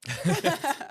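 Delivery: sudden high laugh; burst of laughter. Real, not acted. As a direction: laugh out loud at the joke. Laughs at the HR joke.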